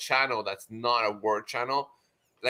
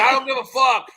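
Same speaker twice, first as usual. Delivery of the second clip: louder and higher with a laugh; laughing defiance; 9 semitones higher, 8 dB louder than usual. Retold or imitated. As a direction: toss it off with a cackle. Voicing Oz dismissing them.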